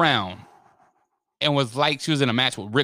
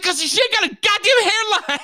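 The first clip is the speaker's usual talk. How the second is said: much higher, laughing through it; laughing rant; 4 dB louder than usual. Retold or imitated. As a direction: crack up while saying it.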